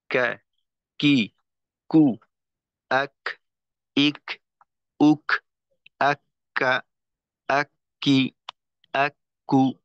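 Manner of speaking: কা কি কু, আক ইক উক, then আক্কা আক্কি আক্কু with a held doubled k; tajweed kaf drill. Shown as a demonstration. ওস্তাদ কঠিন হরফ দেখাচ্ছেন, কাফ জোরালো স্পষ্ট উচ্চারণে. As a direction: show the kaf stop with a puff of air. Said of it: "হামসের ক্ষেত্রে কাফ".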